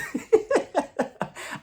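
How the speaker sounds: high breathy laugh. Real, not acted. Laugh after joke.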